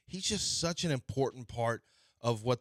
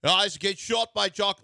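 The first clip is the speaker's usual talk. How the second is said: Jock for Jack in a loud, higher mock Australian; mock Australian announcer; 5 semitones higher, 5 dB louder than usual. Host imitating an Australian announcer, mock Aussie accent. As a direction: call the shot like a hammed-up Aussie. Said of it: "announcers ... say".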